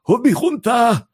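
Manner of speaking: high, slow shouted plea with a lisp. Retold or imitated. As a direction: cry out with a thick slushy lisp. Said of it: "lispelt so ein bisschen".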